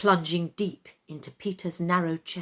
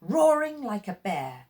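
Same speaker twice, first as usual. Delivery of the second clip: usual speaking voice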